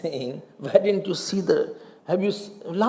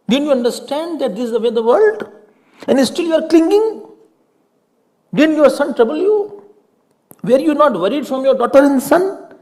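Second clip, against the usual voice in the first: higher and louder, hammering each question; forceful preaching; 6 semitones higher, 4 dB louder than usual. Acted. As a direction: scold the congregation with rising force. Rising rebuke, preaching hard.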